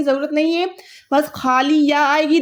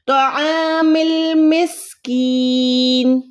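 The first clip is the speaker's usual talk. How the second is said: slow melodic tajweed chant; Quran recitation; much slower than usual. Acted. Tutor reciting the verse for her student: slow tajweed chant. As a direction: chant the verse slowly and evenly.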